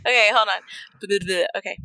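ordinary talk

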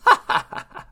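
loud villainess cackle; short, loud mocking cackle; queen's scornful laughter; evil laugh. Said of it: "Queen Alyssa just laughed".

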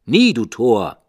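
loud harsh raven reply; raven's retort. A narrator's reading. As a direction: snap back as the raven. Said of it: "Sprach der Rabe".

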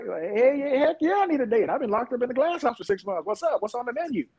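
high-pitched, hammed-up eagerness; eager acceptance. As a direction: jump at the date, voice way up. Retold eager yes to a date.

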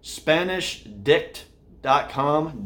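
slow spelled-out. Shown as a demonstration. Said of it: "this is tough for the podcast viewers".

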